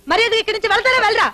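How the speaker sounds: fast furious demand to leave; angry rapid order. Acted. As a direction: rattle them out of the house, fast. Angry wife throwing them out. (వేగంగా కోపంగా గద్దింపు)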